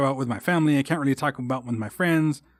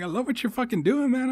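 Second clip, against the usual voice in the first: much higher, gushing compliment; enthusiastic praise; 10 semitones higher than usual. Retold or imitated. Student praising band director, pitched high with enthusiasm. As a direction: gush to your old teacher. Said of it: "I was like".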